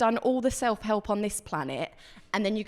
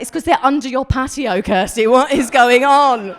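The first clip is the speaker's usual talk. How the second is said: much louder, laughing accusation; mock accusation; 8 dB louder than usual. She accuses Kirsty, louder and laughing. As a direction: call it out at her, laughing. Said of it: "I'm like".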